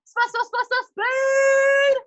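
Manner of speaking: stuttered "spa" bursting into a long, loud "speed"; energetic demo. Shown as a demonstration. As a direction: build from short "spa" hits to a shouted "speed". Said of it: "you have to say it like that".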